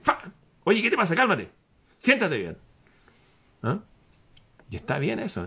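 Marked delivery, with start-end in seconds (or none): 0.0-3.8 put-on higher, a scolding old grandfather calming you down; an ancestor telling you to sit up straight; lightly voiced grandfather telling him off. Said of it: "viene y dice".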